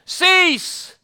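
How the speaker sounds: sudden high-pitched command; retold yell. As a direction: call out a one-word order to the wind. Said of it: "he yells out to the".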